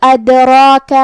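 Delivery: ادراک with a full, heavy r and long dark aa; ادراک, loud demo with heavy r. Shown as a demonstration. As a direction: thicken the را in ادراک and hold it. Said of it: "بھاری آواز میں پڑھیں گے".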